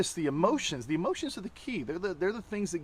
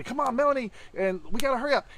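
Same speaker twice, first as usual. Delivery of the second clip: impatient hurrying; 7 semitones higher, 4 dB louder than usual. Retold or imitated. Husband imitates himself rushing his wife, impatiently. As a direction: pitch up, pushing her to hurry. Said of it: "I'll, like, be".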